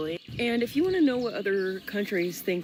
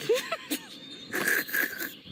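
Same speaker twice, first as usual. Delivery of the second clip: high laughing burst. Real, not acted.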